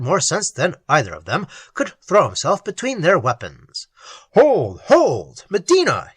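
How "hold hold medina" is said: loud urgent cry to stop the duel; exclaimed. A narrator's reading. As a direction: call out to hold them apart. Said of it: "he exclaimed".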